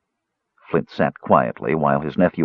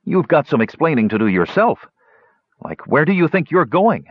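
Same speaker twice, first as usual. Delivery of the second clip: higher-pitched nephew throwing it back; sullen retort; 8 semitones higher than usual. Acted. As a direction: stress YOURSELF as you answer back. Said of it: "fixed him with a sullen glare".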